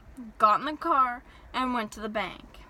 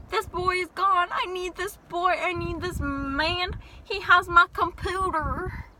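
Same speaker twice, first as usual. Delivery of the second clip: mock desperate; 6 semitones higher, 4 dB louder, slower than usual. Desperate searching for the clerk.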